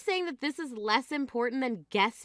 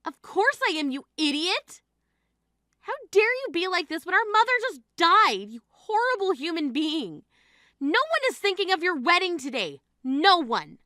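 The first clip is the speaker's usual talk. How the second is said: high, forceful, angry tirade; furious outburst; 4 semitones higher, 3 dB louder than usual. Acted. Sister at mother's funeral berating the bride, furious. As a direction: lash out with pitch and volume raised.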